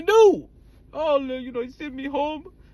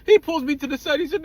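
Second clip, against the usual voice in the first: usual speaking voice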